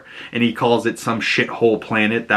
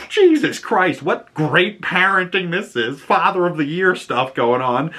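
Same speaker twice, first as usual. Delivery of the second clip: sarcastic disbelief; 7 semitones higher than usual. Retold or imitated. Own sarcastic reaction to parenting.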